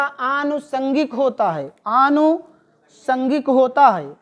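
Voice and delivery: आनुषंगिक spelled out slowly, syllable by syllable; slow dictation. Shown as a demonstration. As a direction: drill the right form आनुषंगिक slowly. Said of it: "आनुषांगिक नहीं होता". (धीरे-धीरे सही वर्तनी बताना)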